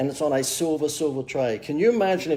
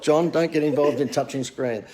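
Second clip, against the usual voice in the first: ordinary talk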